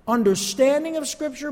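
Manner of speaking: ordinary talk, nothing marked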